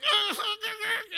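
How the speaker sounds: high, loud choked cackle; uncontrolled laughter. Retold or imitated. Losing it at dad's deathbed, choked laughter. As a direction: imitate laughing out of control. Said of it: "I am laughing out of control".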